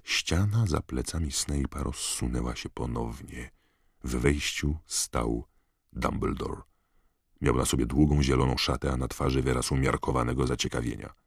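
ordinary delivery

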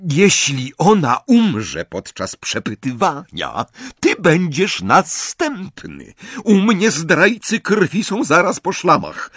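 high-pitched, emphatic menace; threaten, then command with relish; menacing threat. A narrator's reading. Bellatrix warns Ron and instructs Greyback, menacing.

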